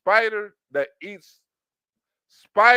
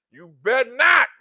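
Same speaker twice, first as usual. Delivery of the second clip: higher, louder sassy warning; sassy threat; 5 semitones higher, 6 dB louder than usual. Retold or imitated. Woman threatening the guy, sassy warning. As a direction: snap the warning back.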